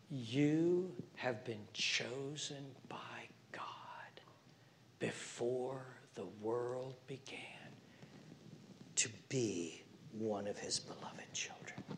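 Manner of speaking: slow, hushed whisper; tender whisper. Real, not acted. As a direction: soothe them with a secret. Whispers assurance to anxious listeners.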